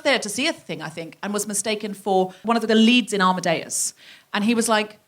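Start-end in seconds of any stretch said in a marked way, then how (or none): none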